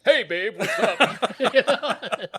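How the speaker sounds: high, loud, laughing nonchalance; husband greeting wife as if nothing happened; mock casual greeting. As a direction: play it cool while cracking up.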